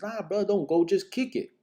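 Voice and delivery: friend brushing it off. Retold or imitated. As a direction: talk him out of going like a relaxed friend.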